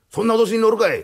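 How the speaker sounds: loud, high-pitched rebuff; defiant retort. Acted. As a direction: throw the warning back at him. 脅しに言い返す泥棒. (声高に突っぱねる)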